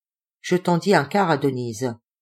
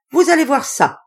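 high, loud playful shout; cry; 9 semitones higher, 6 dB louder than usual. Acted. Denise shouting a dare at the narrator, high and loud. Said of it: "cria Denise".

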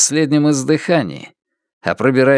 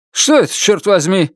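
startled; 6 semitones higher than usual. Acted. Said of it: "вскинулся братец Лун".

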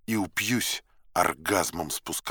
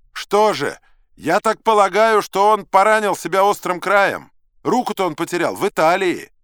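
high, very loud voice; high-pitched, booming reply; loud hard-of-hearing man; 11 semitones higher, 10 dB louder than usual. A narrator's reading. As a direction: blare it out like someone who can't hear himself. Hard-of-hearing man answers narrator's shouting.

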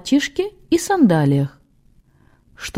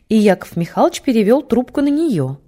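ordinary delivery, nothing marked

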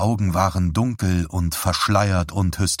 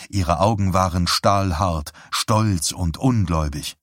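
ordinary delivery

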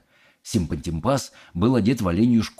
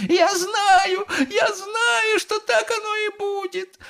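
very high tearful shriek; wailing; slower than usual. A narrator's reading. Mother sure her son is doomed, wailing. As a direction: cry out hysterically as a distraught mother. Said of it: "вопила миссис Глуп".

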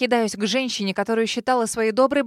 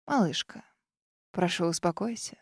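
softly soothing; 4 semitones lower, 7 dB quieter than usual. A narrator's reading. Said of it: "говорит она и улыбается".

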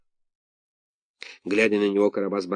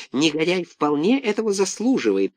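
high, fawning henchman voice; raised, wheedling agreement; Duremar voice; 7 semitones higher than usual. A narrator's reading. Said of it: "подтверждал Дуремар".

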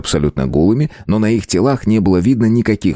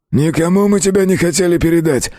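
higher-pitched defensive whine; pleading captive thug; 10 semitones higher than usual. A narrator's reading. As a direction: make excuses in a strained high voice. Gang captive pleads his case. (пленный бандит оправдывается, высоким голосом)

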